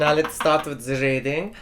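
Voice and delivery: mock German-style show host; botched Brazilian accent that turns German; put-on Germanic accent, crisp consonants; German-sounding Brazilian accent. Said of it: "my Brazilian guy is German".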